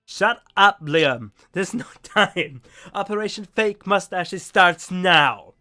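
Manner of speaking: loud rallying shout. Acted. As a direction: yell it like a battle cry. Character yelling at teammate.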